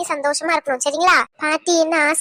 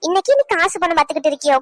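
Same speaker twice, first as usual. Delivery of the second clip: ordinary talk, nothing marked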